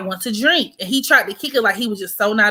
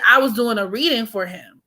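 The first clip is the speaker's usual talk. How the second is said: ordinary talk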